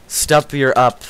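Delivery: loud final stop. Real, not acted. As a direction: tell them to stop, voice up.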